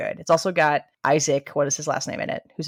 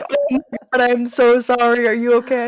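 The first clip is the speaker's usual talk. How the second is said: high, loud, worried voice for her partner; gasping sorry, much higher; alarmed apology; 7 semitones higher, 6 dB louder than usual. Retold or imitated. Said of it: "she was like".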